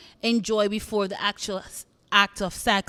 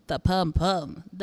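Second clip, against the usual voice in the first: "The pum pum" said slowly and lower, drawn out the Jamaican way; 5 semitones lower, 3 dB quieter, slower than usual. Shown as a demonstration. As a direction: drag out "pum pum" low and slow in a Jamaican lilt. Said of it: "say it with me".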